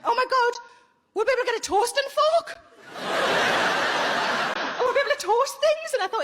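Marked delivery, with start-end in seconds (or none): 0.0-2.6 higher, louder thrilled gasp; excited boyfriend. Retold or imitated. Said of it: "He just went". 4.8-5.9 higher, louder thrilled gasp; excited boyfriend. Retold or imitated. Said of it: "He just went".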